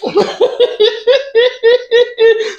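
long, high, rapid giggle; helpless high laughter; high-pitched giggling fit. Real, not acted. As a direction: crack up in a long squealing giggle. Comedian cracks up at own story.

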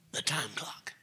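whisper. Real, not acted. Points to the clock mid-sermon.

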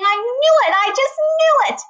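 very high, loud delighted cry; gleeful crowing. A narrator's reading. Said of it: "hear her laughing and saying".

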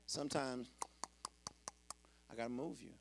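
normal talking voice

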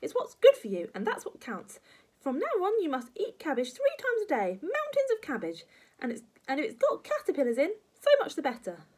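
high, loud granny harangue; bossy old woman laying down the law; lecturing grandma. Acted. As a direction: press the point relentlessly.

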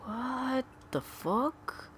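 high, slow drawn-out what the fuck; stunned disbelief. Real, not acted. Gamer who can't find the item, drawn-out high disbelief.

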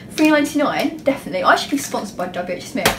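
ordinary delivery

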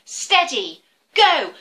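loud ready steady go; rising loud starting call; race start call. Acted. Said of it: "What do we say?".

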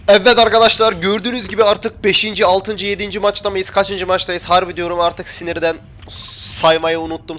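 high, energetic address to viewers; worked-up gaming host opening; streamer's intro. Real, not acted. Opens new match for viewers.

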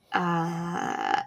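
very soft, stalling uh; hesitant uh. Retold or imitated. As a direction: freeze before answering. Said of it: "And he's like".

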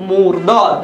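"মুরদাদ" in two careful syllables, mur-dod; word pronunciation demo. Shown as a demonstration. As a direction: say "মুরদাদ" as a model word, falling. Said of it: "সংক্ষিপ্তভাবে".

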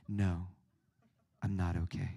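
put-on low, sulky teen no; melodramatic sulk. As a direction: mope it like a dramatic teenager. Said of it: "Su-, super melodramatic".

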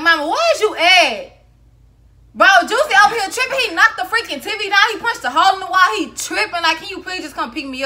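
loud, high, fired-up rant; fired-up take. Shown as a demonstration. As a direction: play it upset and agitated to fool mom. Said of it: "I should have been like".

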